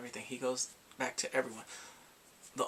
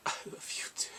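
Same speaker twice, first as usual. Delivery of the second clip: breathy whisper of a love line; 4 dB quieter, faster than usual. Retold or imitated. Retelling the drama's love scene, whispered. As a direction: whisper it tenderly like the character.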